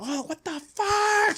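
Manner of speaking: high, slow, frightened mock scream; mock scared girlfriend. Retelling girlfriend in dark bedroom, high slow mock scream. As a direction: squeal it like she saw something. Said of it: "She screams".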